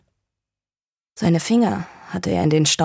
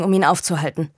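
ordinary delivery

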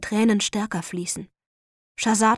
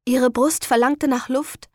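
ordinary delivery

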